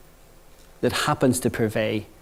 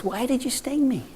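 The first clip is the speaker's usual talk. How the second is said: drowning frog; 10 semitones higher, 6 dB quieter than usual. Acted. Dying frog voiced.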